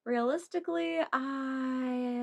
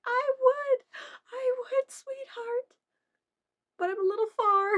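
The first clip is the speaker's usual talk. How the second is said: put-on very high cooing to a toddler; sweet cooing voice; 12 semitones higher, 5 dB louder than usual. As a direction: coo tenderly to a little girl. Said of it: "I'm like".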